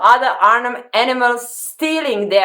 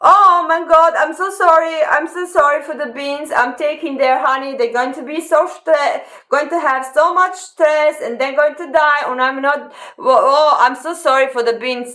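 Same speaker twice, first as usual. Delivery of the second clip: sobbing fake regret, pitched up; mock-sorry bear; 5 semitones higher than usual. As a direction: ham up a weepy guilt-ridden bear. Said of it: "he can not going to say".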